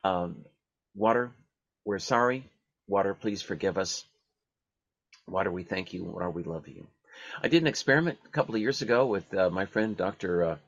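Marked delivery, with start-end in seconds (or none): 0.0-6.9 prayer recitation, fading. Acted. Closing the water prayer with listeners, softening recitation. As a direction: let the last lines of the prayer sink to a near hush. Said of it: "And one more time".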